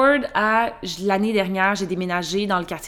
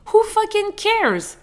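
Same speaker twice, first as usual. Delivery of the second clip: exasperated; 12 semitones higher, 3 dB louder, much slower than usual. Real, not acted. Exasperated dismissal of precision.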